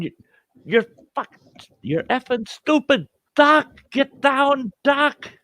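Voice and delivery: high-pitched, frantic scolding; exasperated yelling. Retold or imitated. Man pleads with oblivious bowler, exasperated high yelling. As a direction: shout it in exasperation, stretching out the command. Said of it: "And I, I'm going".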